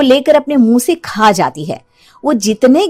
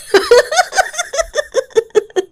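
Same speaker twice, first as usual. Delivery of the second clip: evil witch cackle. Acted. Woman turns into witch.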